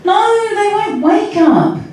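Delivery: put-on very high little-kid whine; child's wail. A narrator's reading. Little Chrissy to Sally on the phone, wailing. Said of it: "There was a wail".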